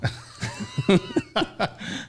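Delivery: bursting into high laughter; real laugh. Real, not acted. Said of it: "That was like a marshal".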